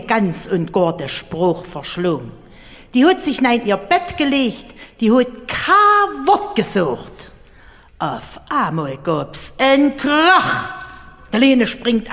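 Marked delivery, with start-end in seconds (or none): none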